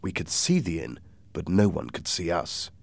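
usual speaking voice

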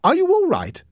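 high, loud worried question; anxious sister. A narrator's reading. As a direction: call out in concern. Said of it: "Margot asked".

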